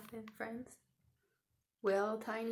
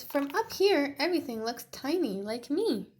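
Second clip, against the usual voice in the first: higher, louder delighted voice; Tiny in the air; 6 semitones higher, 7 dB louder than usual. Acted. Tiny up in the sky, delighted. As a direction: marvel at the view.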